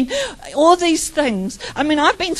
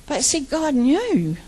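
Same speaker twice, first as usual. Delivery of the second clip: ordinary delivery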